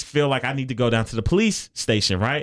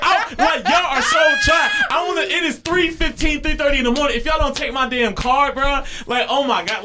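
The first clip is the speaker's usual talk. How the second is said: angry rant; 11 semitones higher than usual. Retold or imitated. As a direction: vent at the clerks like it's 3 AM. Ranting at impound staff.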